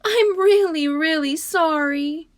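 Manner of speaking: high, loud pleading wail; child crying. Acted. Little girl begging her upset mother, loud tearful wail. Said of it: "Harriet cried".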